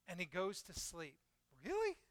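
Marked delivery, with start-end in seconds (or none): 1.6-2.0 very high-pitched incredulous squeak; mock disbelief. Preacher on Jonah sleeping while hiding, mock disbelief. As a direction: question it in a high, doubting voice.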